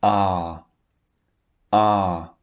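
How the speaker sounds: Ah with mouth half open, held long; long ah demo. Shown as a demonstration. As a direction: model Ah with relaxed sides. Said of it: "It's in the middle".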